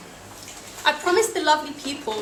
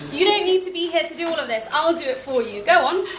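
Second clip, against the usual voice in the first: brisk imitated Russian accent, opening higher; 4 semitones higher than usual. Russian hostess sending her guest off, imitated Russian accent. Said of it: "in her accent".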